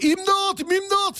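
high mock film scream; mock cries for help. Host imitates Transformers screams coming from the TV. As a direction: imitate the movie's screaming voices. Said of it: "filan sesler var".

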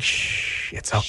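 hushed, comforting mock whisper; calm them down like a child; gentle shushing whisper; soothing whisper. Mock soothing anti-masker.

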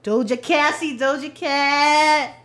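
loud, high, drawn-out cheer; playful calling out. Pretend. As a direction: holler the name and stretch it long. Playfully calling out an artist's name.

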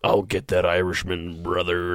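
deep mock-wrestler voice; Hulk Hogan. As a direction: vow it in a low wrestler impression. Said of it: "Hulk Hogan".

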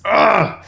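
a high, crying fan groan; anguished high groan. Real, not acted. A fan groaning that Nightwing lost his classic look. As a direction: wail it out like a heartbroken fan.

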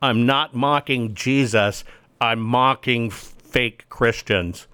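mocking said slow and firm; stress on mocking. Real, not acted. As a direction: hammer home mocking, slowly. Clarifying, slowed emphasis.

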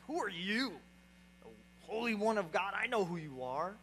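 lightly voiced evil demon; a hint of demonic menace. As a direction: lightly play the demon sizing up an enemy. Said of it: "like this evil, demonic type of tone".

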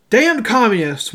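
lightly acted angry yell; yelled threat. A narrator's reading. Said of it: "one of the men yelled".

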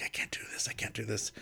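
whispered, scared I can't do this; whispered panic. Retold or imitated. Performer whispers to husband about the daunting play, scared. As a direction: confess it under your breath.

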